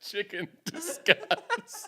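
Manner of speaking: high, very slow mock slogan; teasing, drawn-out imitation. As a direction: draw each word out high and slow. Mimics the slogan just said.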